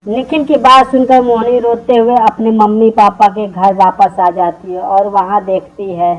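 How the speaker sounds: ordinary delivery, nothing marked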